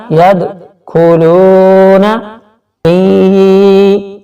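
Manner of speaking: slow chanted tajweed recitation; Quran recitation. Shown as a demonstration. Teacher demonstrating recitation to students, slow and chanted. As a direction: draw out each syllable in melodic recitation. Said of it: "فی کو تھوڑا سا کھینچیں گے".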